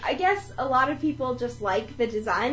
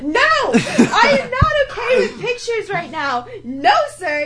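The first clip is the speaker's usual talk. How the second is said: high, loud, slow indignant refusal; mock yell; 8 semitones higher, 5 dB louder than usual. Friend retelling Ben yelling at the picture-taker, mock yell. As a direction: imitate him laying down the law, each word drawn out. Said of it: "yelling at this guy".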